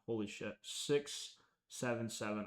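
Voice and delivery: ordinary delivery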